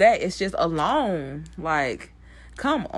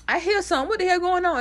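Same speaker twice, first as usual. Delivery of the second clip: high, puzzled Greg voice, much higher; mock Greg; 13 semitones higher, faster than usual. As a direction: squeak it out like a nosy coworker. Voicing Greg hearing crying.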